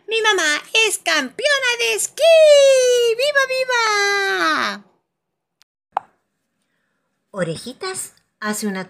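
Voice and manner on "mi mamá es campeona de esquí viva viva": vítores agudos, cada vez más alargados; niña que grita orgullosa de su mamá; júbilo infantil. A narrator's reading. Peppa celebra a su mamá. (celebración a gritos, muy aguda)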